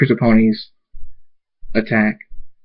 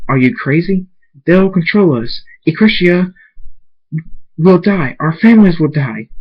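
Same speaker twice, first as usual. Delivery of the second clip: higher, agitated character voice; panicked protest; 7 semitones higher, 4 dB louder than usual. A narrator's reading. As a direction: argue back in alarm. Panicked argument in a narrated story.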